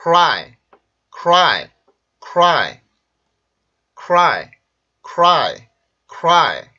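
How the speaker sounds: loud one-syllable "cry", lips rounded on cr; English pronunciation model. Shown as a demonstration. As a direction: punch "cry" with no vowel between k and r. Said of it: "자, 해볼게요".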